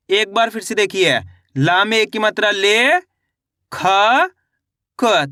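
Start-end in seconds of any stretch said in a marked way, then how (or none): 2.5-5.2 "ले, खा, क" held long, each standing alone; slow teaching sound-out of "ले... खा... क"; syllable by syllable. Shown as a demonstration. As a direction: break it into "ले", "खा", "क" with clear pauses. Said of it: "तो मिलके बन जाएगा लेखक".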